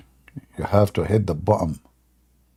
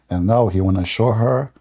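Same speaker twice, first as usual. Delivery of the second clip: usual speaking voice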